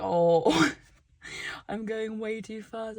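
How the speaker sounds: ordinary delivery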